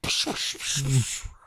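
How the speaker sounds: loud, high laugh. Real, not acted. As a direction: burst out laughing. Laughing at the story.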